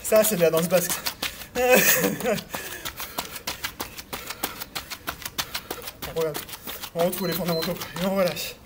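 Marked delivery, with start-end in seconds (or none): 1.0-6.1 long, mostly voiceless chuckle; amused laugh. Real, not acted. Coach after joking about Basque dance, amused laughing. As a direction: laugh at your own joke.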